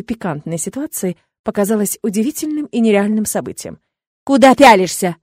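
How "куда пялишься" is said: loud indignant challenge; forceful, outraged question; angry yell. A narrator's reading. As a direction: yell at someone to back off. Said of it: "заорал уже Ворт".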